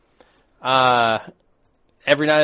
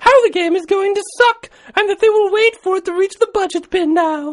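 sustained, very high falsetto letter reading; man putting on a high lady voice; British lady voice; 4 dB louder than usual. Acted. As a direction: read a letter as a prim falsetto British woman. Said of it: "How's your lady voice, [name]?".

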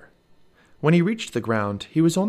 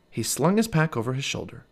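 usual speaking voice

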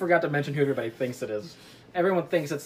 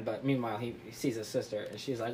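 usual speaking voice

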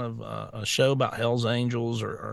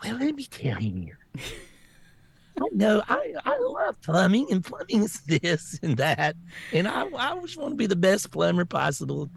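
high, affected put-on voice; mock politician-style plumber; 4 semitones higher than usual. Plumber earnestly answering a customer about a leak, mock-precious voice. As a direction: make a plumber sound absurdly precious, pitch jumping up. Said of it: "Have you ever heard of a biker talk to anybody like that?".

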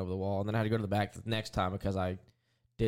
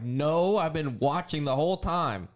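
higher and louder, insistent protest; indignant retort; 6 semitones higher, 5 dB louder, slower than usual. Retold or imitated. Driver retelling his protest to the tire changer, higher and louder. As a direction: push back hard at the crew guy.

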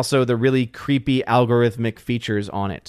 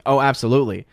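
ordinary talk

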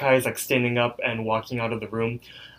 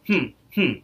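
scheming hmm; 5 semitones higher than usual. Retold or imitated. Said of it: "That's a hmm for this episode".

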